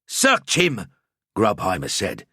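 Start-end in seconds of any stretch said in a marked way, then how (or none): 0.0-0.9 put-on slow, higher, gruff Bretonnian-accented accuser. A narrator's reading. Grubheimer accusing the priest in a strong Bretonnian accent. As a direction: point him out in a heavy Bretonnian accent. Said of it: "his voice gruff, his accent strong".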